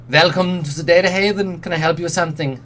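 put-on high, punchy heavy German accent; clipped German accent. Tech vendor greets a customer, clipped German accent. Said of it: "clipped, heavily accented German".

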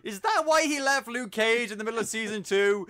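loud, high incredulous demand; mock outrage. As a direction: demand an explanation in indignant disbelief. Mock outrage at actor's career move.

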